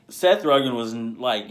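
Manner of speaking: usual speaking voice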